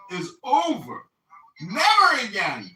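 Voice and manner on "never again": loud mock outburst, stress on Never; hammed-up cry of Never again; dramatic shout.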